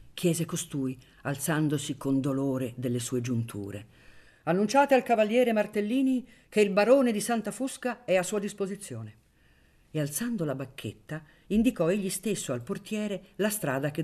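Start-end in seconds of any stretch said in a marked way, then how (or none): 4.4-9.1 high, loud, commanding announcement; imperious order. A narrator's reading. Baron sending the porter to announce him, imperious. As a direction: announce it like a lord to a servant.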